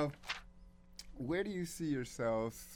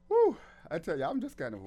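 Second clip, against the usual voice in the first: high, loud exhale into speech; overwhelmed, catching breath; 8 semitones higher, 11 dB louder, faster than usual. Real, not acted. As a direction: blown away and needing a breath. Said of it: "I have to catch my breath".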